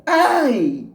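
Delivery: high, drawn-out yelp of pain; hurt shout, pitched high and falling; pained cry. A narrator's reading. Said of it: "se machuca e grita".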